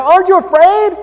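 high, loud alarmed question; frightened servant. Acted. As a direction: cry out in alarm. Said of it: "Servant says to Elisha".

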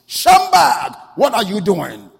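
very loud, high shout; booming angel's wake-up call; rousing shout. Acted. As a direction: shout a sleeper awake. Acting angel shaking sleeper awake violently.

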